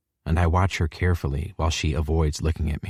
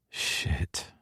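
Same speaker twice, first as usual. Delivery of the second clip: unvoiced breathy curse under breath; whispered curse; 10 dB quieter than usual. A narrator's reading. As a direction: mutter it almost silently. Narrator's quiet inner curse.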